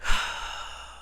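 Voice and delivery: long, heavy exhale; drawn-out breathy sigh; weary sigh. Real, not acted. Recalling frustration of being sick.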